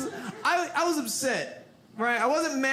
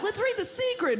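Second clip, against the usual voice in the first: eager party dude; 10 semitones higher than usual. Retold or imitated. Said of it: "One dude's like".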